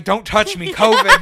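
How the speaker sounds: high, loud comic yelp breaking into laughter; hammed-up alarm, climbing on the last word; mock panicked shout. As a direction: shriek it as a joke warning. Mock panicked recoil from helper.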